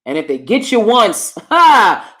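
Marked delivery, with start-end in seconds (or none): 1.4-2.2 loud laugh. Real, not acted. Laugh on line.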